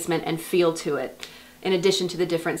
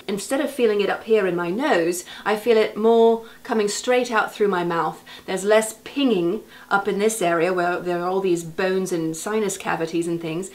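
ordinary delivery

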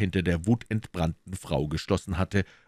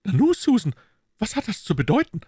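higher, louder startled lady of the house; surprised lady; 12 semitones higher, 4 dB louder, faster than usual. Acted. Lady of the house startled at her maid, higher voice.

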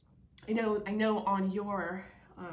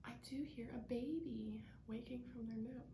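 whisper; 3 semitones higher, 10 dB quieter than usual. Real, not acted. Babies napping nearby.

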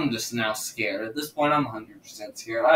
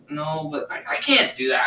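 ordinary delivery

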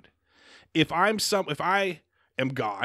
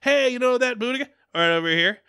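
higher, louder mock-casual alien call; acted alien; 9 semitones higher, 5 dB louder than usual. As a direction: hail them like a casual visiting alien, pitched up. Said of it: "they're gonna be like".

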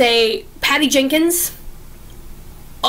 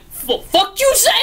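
higher-pitched, incredulous comeback; mock indignant retort; 7 semitones higher than usual. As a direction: act out her outraged double take. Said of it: "And she's like".

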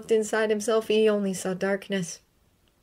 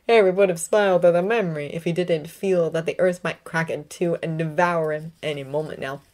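ordinary delivery, nothing marked

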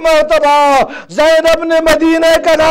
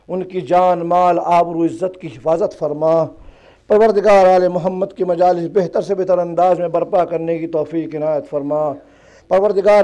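hushed closing prayer; 7 semitones lower, 4 dB quieter than usual. Real, not acted. Preacher closing the majlis with a quiet dua. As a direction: pray quietly, reverent and humble.

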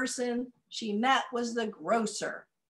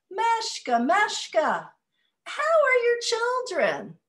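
high, sing-song greeting; friendly villager; 14 semitones higher, 7 dB louder, slower than usual. Acted. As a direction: call her name sweetly. Dressmaker greets Meshka in story.

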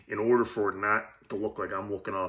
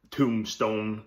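ordinary talk

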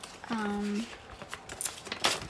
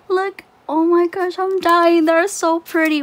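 high, loud fangirl delight; thrilled, pitched-up exclaiming; excited gushing; 6 semitones higher, 6 dB louder than usual. Real, not acted. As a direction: gush as if overwhelmed by how lovely it is. Gushing over washi just revealed.

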